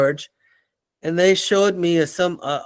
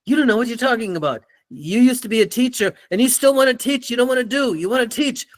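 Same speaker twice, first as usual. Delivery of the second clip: higher-pitched, scornful put-down in imitation; mock belittling boss; 6 semitones higher, faster than usual. Boss belittling somebody in a meeting, mock scornful rant. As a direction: imitate a boss insulting his staff. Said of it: "he would belittle people".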